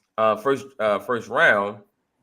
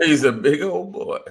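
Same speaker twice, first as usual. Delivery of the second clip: laughing echo; 6 semitones higher, 3 dB louder than usual. Retold or imitated.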